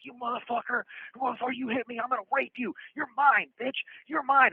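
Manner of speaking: high-pitched imitation of an enraged attacker; acted threat. Attacker storming after the man who hit him, mock menacing. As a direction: voice a ranting aggressor, pitched well up.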